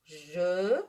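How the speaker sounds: "je" drawn out slowly while writing; slow dictation. Shown as a demonstration. Teacher dictates slowly as she writes on the board. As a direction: stretch the word as you write it. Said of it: "je commence".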